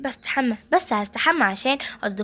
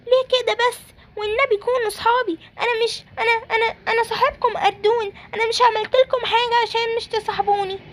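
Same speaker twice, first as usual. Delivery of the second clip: put-on high, plaintive child voice; acted hurt, pitched high; hurt pleading; 6 semitones higher than usual. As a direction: plead with friends not to leave you. Rejected child pleads with friends.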